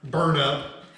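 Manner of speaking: ordinary talk, nothing marked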